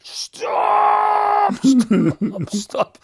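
high, loud mock scream of stop; yelling to halt the car, hammed up; panicked passenger shouting stop, retold with a laugh. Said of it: "he was screaming".

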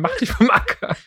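hammed-up grumpy old man. Laughing at his own line, mock old man. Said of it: "so ein alter Mann".

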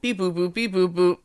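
playful robot voice, little change; a light joke robot. Pretend. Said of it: "I am a robot".

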